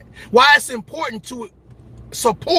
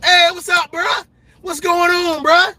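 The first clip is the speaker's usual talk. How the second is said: much higher, loud mock-buddy hello; fake friendly greeting; 9 semitones higher, 3 dB louder than usual. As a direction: play the fair-weather friend. Fair-weather friends pulling up.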